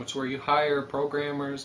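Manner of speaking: usual speaking voice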